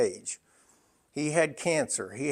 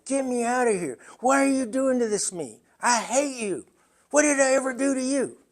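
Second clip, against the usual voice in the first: much higher, pleading and accusing; begging mother; 9 semitones higher than usual. Retold or imitated. Mother begging her husband to take her home, high pleading voice. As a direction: plead desperately, then turn it into blame. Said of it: "my mom begged my father".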